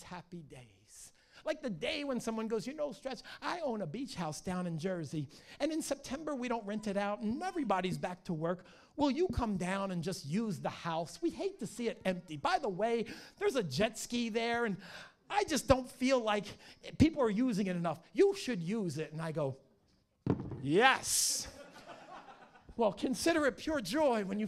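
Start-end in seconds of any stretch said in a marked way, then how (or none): 2.7-19.1 eager friend. Retold or imitated. Friend offering his beach house. 20.6-21.5 eager yes. Retold or imitated. Accepting the beach house offer.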